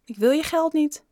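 high, wounded refusal; hurt. A narrator's reading. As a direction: turn the money down, stung. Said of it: "Bob keek gekwetst". (gekwetste, hoge weigering)

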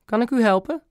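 high piping politeness; squeaky little voice. A narrator's reading. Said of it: "met een vreemd piepstemmetje". (hoog, vreemd piepstemmetje)

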